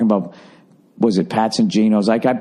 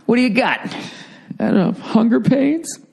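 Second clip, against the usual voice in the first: higher, teasing take on the gruff counter lady; playful higher imitation of the waitress; mock waitress; 11 semitones higher than usual. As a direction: toss it back like a wisecracking diner waitress. Acting out the waitress and himself.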